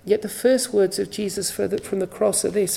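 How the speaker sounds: normal talking voice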